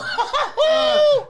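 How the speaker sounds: high loud laughing yelp; laughing mock cry of surprise. Imitating mate's drunk reaction.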